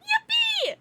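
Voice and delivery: very high, loud "Yippee!"; high loud squeal of "Yippee!"; cheery yippee. Shown as a demonstration. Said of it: "So everyone say".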